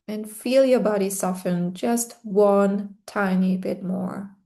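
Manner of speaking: usual speaking voice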